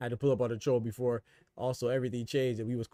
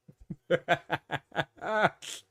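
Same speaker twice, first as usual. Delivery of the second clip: mocking laugh; 5 semitones higher than usual. Real, not acted. Mocking viewer Jerry.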